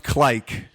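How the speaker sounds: Clyke with a long i sound, like klike; mock pronunciation. Shown as a demonstration. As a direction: say clicks as Clyke, rhyming with like. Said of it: "I think it's pronounced".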